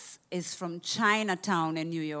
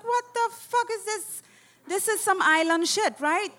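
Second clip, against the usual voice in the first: baffled disbelief; 12 semitones higher, 4 dB louder than usual. Retold or imitated. Baffled by bus stopping everywhere.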